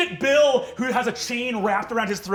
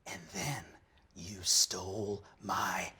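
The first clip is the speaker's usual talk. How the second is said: much softer, fading into a whisper; greaser whisper; 4 semitones lower, 8 dB quieter than usual. Acted. Johnny Spells confronting Fabian, fading to a whisper. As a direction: breathe it out like a wounded greaser. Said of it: "He goes".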